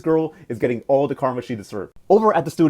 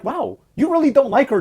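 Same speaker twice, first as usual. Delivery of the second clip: high, amused disbelief; mock surprise; 10 semitones higher than usual. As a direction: play Camilo's teasing wow, pitched way up. Camilo mocking Fabiana's jealousy.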